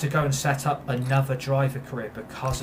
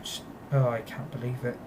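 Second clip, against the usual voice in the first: much softer, deflated; quiet disbelief; 3 semitones lower, 7 dB quieter than usual. Real, not acted. Gamer gives up on the broken career mode, quiet and defeated. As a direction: let it fall away, beaten.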